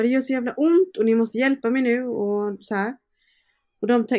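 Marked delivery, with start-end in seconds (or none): 0.0-2.0 high, loud desperate cry for help; retold scream. Mother in labour screaming at the midwives, loud and desperate. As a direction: yell it out in pain, begging. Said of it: "jag skriker bara".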